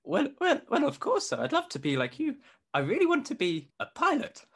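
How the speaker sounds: high, squeaky eager youngster; teenage cadet voice. Acted. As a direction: pitch way up, like an adolescent whose voice is breaking. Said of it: "I'm gonna keep the same voice".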